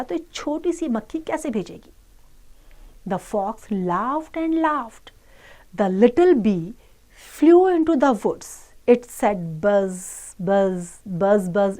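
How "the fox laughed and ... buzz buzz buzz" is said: slow, measured English read-aloud; children's story reading. Acted. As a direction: read slowly to children, word by word. Reads English story slowly to children. (बच्चों को धीरे-धीरे कहानी पढ़कर सुनाना)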